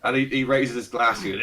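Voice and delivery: ordinary talk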